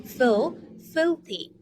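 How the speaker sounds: Fil broken off, then filthy with FIL strong; stress on the first syllable. Shown as a demonstration. Teacher drilling filthy syllable by syllable for learners. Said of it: "put the stress on the first one".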